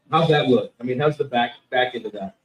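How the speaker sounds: usual speaking voice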